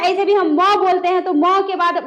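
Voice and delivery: ordinary delivery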